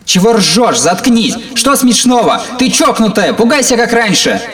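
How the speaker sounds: high, loud furious screaming. Acted. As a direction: scream at her, out of control. Brother screaming at sister.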